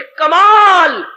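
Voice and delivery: long, drawn-out shout; ringing preacher's cry; emphatic exclamation. Real, not acted. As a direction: stretch the word out at full voice. Preacher driving home a key word.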